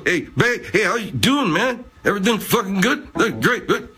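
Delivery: high, goofy mock voice of a cheerful guy; listener's friend imitated in a high, goofy voice; Warren Benman impression. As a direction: put on a jokey high party-guy voice. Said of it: "You're just talking like this. Go".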